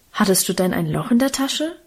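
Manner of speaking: usual speaking voice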